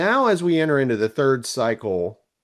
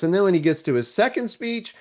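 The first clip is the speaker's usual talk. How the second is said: usual speaking voice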